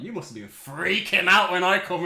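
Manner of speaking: loud, slow, smug reveal; mock triumphant. Telling his girlfriend he knew, loudly and slowly. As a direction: gloat over the surprise. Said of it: "I was like".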